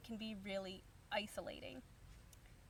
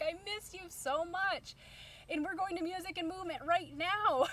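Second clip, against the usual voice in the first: excited reunion; 7 semitones higher, 4 dB louder than usual. Retold or imitated. Retold excited reunion with friend.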